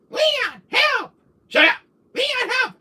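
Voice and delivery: very high squealed pleas; mocking high-pitched damsel cries; mimicked cries for help. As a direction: squeal it in short piercing bursts. Mimics game character's nagging cries.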